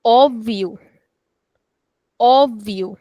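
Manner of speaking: "Óbvio" with the opening o held long and open; stretched vowel. Shown as a demonstration. Teacher reading the first accent example, long open o. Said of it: "it stretches the sound of the vowel".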